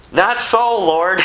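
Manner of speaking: hammed-up exasperated refusal; Peter protesting again. Peter tells the Lord no a third time, hammed-up and loud.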